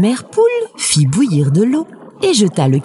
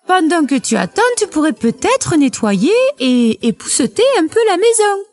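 voix de poule haut perchée; mère poule autoritaire; 10 semitones higher than usual. A narrator's reading. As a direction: demande un service, dans l'aigu. (poule de conte à la voix aiguë)

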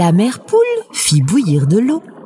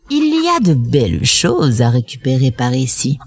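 légèrement grave, voix de loup de conte; loup intéressé; 7 semitones lower than usual. A narrator's reading. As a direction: joue un loup qui se parle à lui-même. Le loup se parle tout bas. (un soupçon de voix de méchant loup)

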